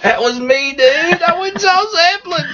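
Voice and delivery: squeaky high stoner dude, building up; excited surfer dude. Acted. High schooler voice, rising.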